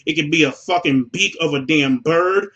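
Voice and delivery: ordinary talk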